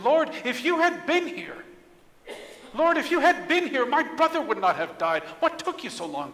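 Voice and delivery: high, loud grieving accusation easing off; angry reproach. Acted. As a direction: confront him with hurt and frustration. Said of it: "Mary and Martha were angry with Jesus".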